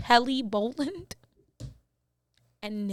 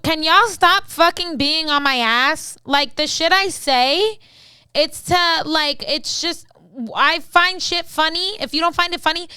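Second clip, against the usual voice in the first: fed-up rant; 4 semitones higher, 6 dB louder than usual. Real, not acted. Ranting back at critical viewers.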